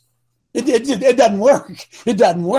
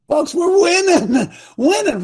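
high, crying shout of victory with a laugh; jubilant cry; 11 semitones higher, 4 dB louder than usual. Real, not acted. Preacher to his audience, a high jubilant shout with a laugh. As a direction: cry it out to the crowd like a win.

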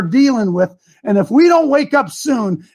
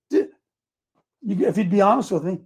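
usual speaking voice

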